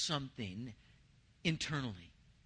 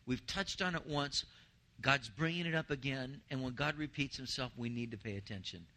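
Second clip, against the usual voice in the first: usual speaking voice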